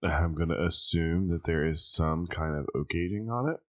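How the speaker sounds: ordinary talk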